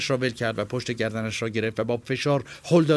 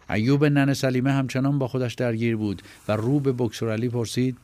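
usual speaking voice

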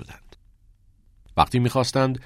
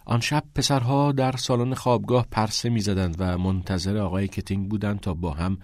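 ordinary delivery

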